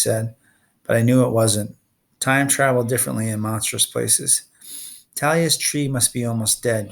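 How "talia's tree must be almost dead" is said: ordinary delivery, nothing marked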